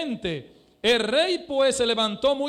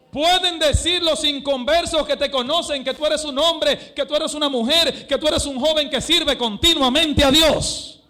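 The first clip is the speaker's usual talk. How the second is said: higher, louder pulpit appeal; preaching appeal; 5 semitones higher, 6 dB louder than usual. Acted. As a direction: press the question on the congregation. (predicando con fuerza, más alto y fuerte)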